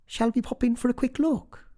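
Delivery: high, quick woman's voice; eager sister. A narrator's reading.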